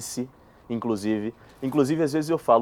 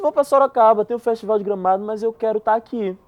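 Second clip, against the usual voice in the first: excited; 9 semitones higher, 7 dB louder than usual. Retold or imitated. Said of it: "Aí eu disse".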